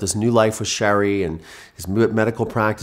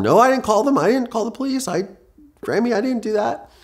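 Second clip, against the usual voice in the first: put-on much higher, pleading kid's denial; boy insisting to his grandmother that he did not call the police, much higher; young self protesting. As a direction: voice yourself as a boy insisting on your innocence. Boy denies calling police to grandmother.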